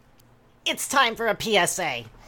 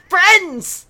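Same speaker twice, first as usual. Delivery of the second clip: proclaiming; 10 semitones higher, 8 dB louder than usual. Acted. Forum post opener shouted.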